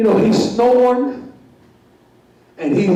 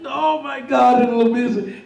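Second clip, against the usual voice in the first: ordinary talk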